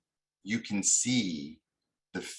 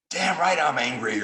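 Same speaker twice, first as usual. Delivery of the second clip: loud, much higher defiant snap; angry retort; 9 semitones higher, 7 dB louder than usual. Retold or imitated. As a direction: throw it back at the mediator. Voicing angry party's reply.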